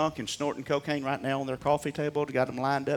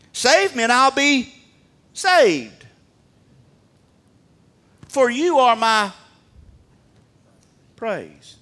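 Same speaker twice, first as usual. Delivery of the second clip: high, loud declaration with pauses; confident cry; 9 semitones higher, 8 dB louder, slower than usual. Acted. Preacher as the prophet praising God, confident shout. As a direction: proclaim it boldly, phrase by phrase. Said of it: "What confidence did Jeremiah have?".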